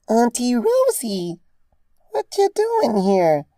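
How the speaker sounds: put-on very high, slow child's voice, cheerful; a small child happy to see her aunt; squeaky little girl greeting; cheerful little filly. A narrator's reading. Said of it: "Dinky asked cheerfully".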